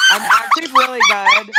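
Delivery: high laughing outcry; laughing shout. Real, not acted. Offended friend defends her rhythm.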